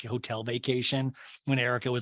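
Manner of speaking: ordinary talk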